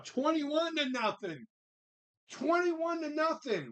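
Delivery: high, slow excited cheer; thrilled about the score. Real, not acted.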